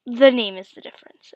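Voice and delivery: firm insistence. Retold or imitated. Dad insisting firmly.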